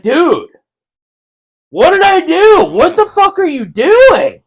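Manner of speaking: high, loud, slow outraged yell; squealed, drawn-out retold outrage; retold mad. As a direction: protest in a high mock-angry shout. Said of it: "I got mad. I'm like".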